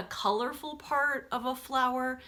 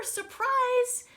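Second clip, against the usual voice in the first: high, loud Surprise!; big storybook surprise; excited reveal; 4 dB louder than usual. Acted. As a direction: burst out the reveal to the kids. Repeated reveal in read-aloud.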